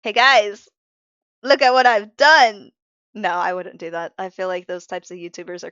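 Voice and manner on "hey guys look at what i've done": louder, hammed-up YouTuber greeting; a hint of a showy YouTuber, louder. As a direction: put on a cringy YouTuber intro voice. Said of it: "Or I could be like".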